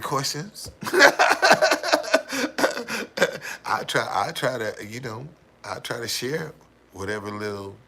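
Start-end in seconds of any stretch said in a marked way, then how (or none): 0.8-3.6 high, loud rattling burst of laughter. Real, not acted. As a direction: burst out in a long high cackle.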